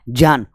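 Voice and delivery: "जान" for ज्ञान, said "jyaan"; "जान" with j in place of gy; correct pronunciation. Shown as a demonstration. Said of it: "तो इसका उच्चारण करेंगे तो क्या होगा?".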